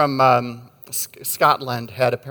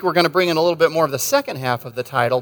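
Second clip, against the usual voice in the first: ordinary talk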